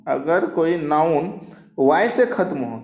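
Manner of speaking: usual speaking voice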